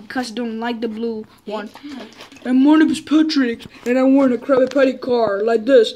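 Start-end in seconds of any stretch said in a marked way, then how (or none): 2.4-5.9 loud goofy Patrick voice. Pretend. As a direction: play Patrick, loud and silly. Kids playing Patrick with toy car.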